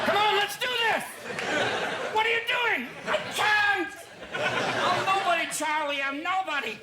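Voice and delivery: high, loud, frantic shouting; mock paranoid panic. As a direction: yell it like a coked-up wreck. Imitating cocaine-paranoid partygoer.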